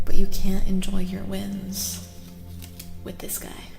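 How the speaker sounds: normal talking voice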